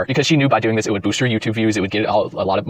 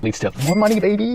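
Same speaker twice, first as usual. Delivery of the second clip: higher, gleeful sing-song; higher gleeful money, baby; smug payoff; 10 semitones higher than usual. Pretend. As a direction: savor the punchline about money. Clip playback, gleeful payoff.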